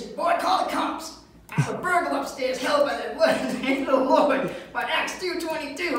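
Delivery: high, loud, hammed-up righteous yell; mock church-lady holler; hollering grandmother. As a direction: imitate grandma calling out urgent orders.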